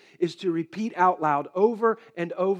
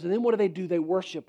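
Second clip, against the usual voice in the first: normal talking voice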